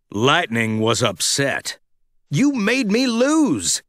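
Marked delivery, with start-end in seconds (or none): 2.3-3.8 a touch of anger, slow and higher; angry. Acted. Lightning blaming Mater for losing, mild anger. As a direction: accuse him, slowly. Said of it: "he told Mater angrily".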